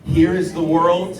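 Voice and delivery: mean every word; leading pairs to speak it earnestly; said like you mean it. Shown as a demonstration. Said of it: "say it like you mean it".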